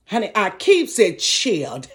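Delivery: slow, sassy drawn-out aside. Real, not acted. Comic bragging to the audience about chilled wine. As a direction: savor it with sass.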